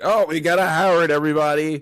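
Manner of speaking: loud, high showman call to the room; hammed-up announcer proudly presenting a Howard; imitating a character's boastful announcement; mock announcement. Mimicking the guy announcing a name.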